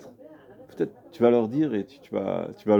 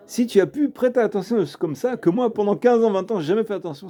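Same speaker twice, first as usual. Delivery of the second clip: higher-pitched, insistent reproach; mildly scolding, pitched up; acted reproach; 7 semitones higher than usual. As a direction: press the point as a rebuke. Tzaddik's reproach acted out in story.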